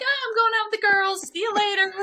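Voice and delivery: very high, airy sing-song goodbye; acted-out cheery fib in a squeaky high voice; breezy cover story. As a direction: toss off a falsely casual, high-pitched goodbye. Breezy fib told to husband.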